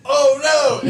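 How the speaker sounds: hammed-up scream of panic; mock panicked cry. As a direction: yelp in horror. Harry sees her about to poop.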